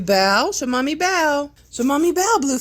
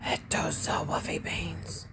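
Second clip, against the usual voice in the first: hushed whisper; soft whispered aside; 7 semitones lower, 14 dB quieter than usual. Real, not acted. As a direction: whisper so the puppies stay asleep. Whispering, puppies asleep in lap.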